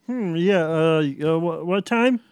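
slurred, hesitant mom; drunk. Acted. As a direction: play it tipsy and confused. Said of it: "Are you drunk?".

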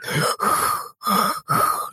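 sharp startled gasp, rising high; a hammed-up startled gasp; mock gasp. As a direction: gasp like you're caught off guard. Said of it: "I'm just like".

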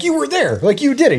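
mock outrage at husband's denial; incredulous insistence. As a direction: insist he was there, half laughing.

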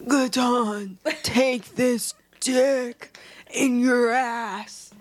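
lightly hammed seductive line; mock romance hero.

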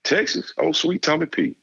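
high-pitched mock coach voice, friendly; imitating the coach. Coach phoning a recruit late at night, high mock coach voice. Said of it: "He said".

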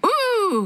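mild high-pitched "ooh" screech. A narrator's reading. Boy hit by a wall of water, high contained screech. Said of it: "he screeched".

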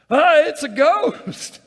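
loud high slow hammed-up scream; mock fright. Frightened disciples in the boat, hammed-up ghost scream. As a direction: shriek like you've seen a ghost, played for laughs.

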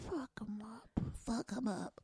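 put-on small whispered child voice; imitating a toddler. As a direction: mimic the little girl's whisper. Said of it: "She said".

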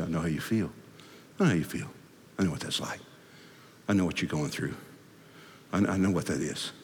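low, soft comforting; tender, hushed reassurance; quiet empathy. Acted. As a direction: murmur understanding to someone suffering. Jesus comforting alongside the sufferer.

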